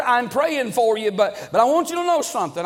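ordinary talk, nothing marked

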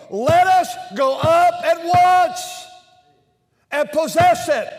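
loud, high, slow battle call; rally the people to go up now; bold rallying cry. Acted. Caleb rallying the people, loud and high.

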